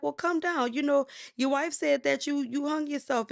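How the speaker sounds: high, loud call upward. Retold or imitated. Searchers yelling up to Shango in the tree, high and loud. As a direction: shout it up into the palm tree.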